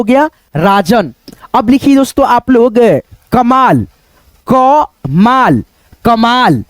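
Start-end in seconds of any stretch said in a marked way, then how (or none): 4.5-5.6 क माल split slowly into क and माल; syllable by syllable. Shown as a demonstration. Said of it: "तो यहाँ पे लिखेंगे क".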